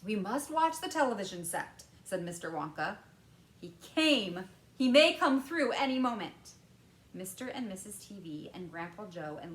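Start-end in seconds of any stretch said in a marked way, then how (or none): 0.0-6.5 louder Wonka directing; lightly voiced Wonka. A narrator's reading. Wonka telling the parents to watch the set. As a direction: urge them to watch.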